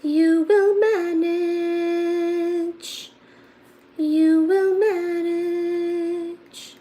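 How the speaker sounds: high, very slow and drawn out; sing-song reassurance. Acted. Teacher consoling a viewer with a backwards screen, slow high sing-song. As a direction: stretch each word to soothe.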